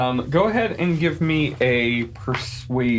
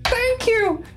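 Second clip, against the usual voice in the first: high, relieved goblin squeal. Acted. Goblin flees.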